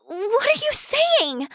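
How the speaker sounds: high-pitched, indignant accusation; appalled, pleading anger pitched high; outraged confrontation. Acted. Healer confronts the one spreading sickness, outraged.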